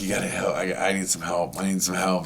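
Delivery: soft, low desperate pleading; quiet plea. Retold or imitated. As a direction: beg softly for help. Pleading with aunt on phone.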